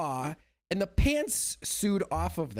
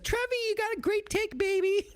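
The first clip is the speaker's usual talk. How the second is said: high-pitched, fast doting wife voice; mock doting wife. Wife gushing over Trevor's take, high-pitched and fast. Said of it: "his wife's like".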